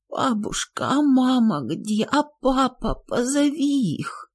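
put-on faint, high, hushed child's plea; weak and quiet. A narrator's reading. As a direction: ask weakly, barely voiced.

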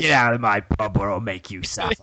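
mock East End London threat; Deathstroke. Villain throwing someone out of his pub, East End accent. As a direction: menace them in put-on Cockney. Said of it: "Deathstroke would just be like".

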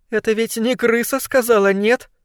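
high, worried question; getting anxious. A narrator's reading. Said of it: "заволновалась злокознь". (взволнованно, высоким голосом)

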